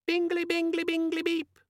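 very high, chirpy electronic chime; cheerful squeaky beep. A narrator's reading. Pocket organizer cheerfully chirping to Vimes. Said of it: "said a cheerful voice from his pocket".

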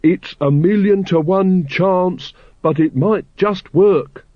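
high, slow, carefully spaced words; slow and distinct. A narrator's reading. Carrot speaking for the gods to hear, slow and distinct. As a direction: say every word slowly and distinctly. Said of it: "speaking slowly and distinctly".

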